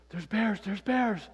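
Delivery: high, alarmed there's bears; imitating a freaked-out daughter. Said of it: "Kaylee starts freaking out".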